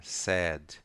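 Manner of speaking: "sad" with a long, drawn-out vowel; stretched vowel. Shown as a demonstration. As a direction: hold the a in "sad" longer. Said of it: "a pronúncia é mais prolongada".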